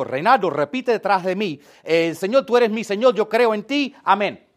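loud, insistent command. Retold or imitated. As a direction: order him to repeat, loudly. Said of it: "si yo agarro a Reinaldo y le digo".

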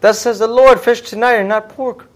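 high, slow, loud proclamation; mock divine decree. Believer claiming God chose dinner, high mock decree. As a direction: call it out high and slow like holy law. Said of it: "who are we to say".

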